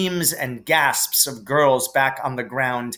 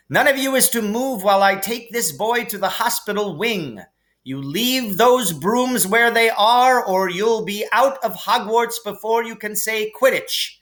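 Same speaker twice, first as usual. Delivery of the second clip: much higher, stern warning to class; stern teacher warning; 9 semitones higher than usual. A narrator's reading. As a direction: lay down the law to the pupils.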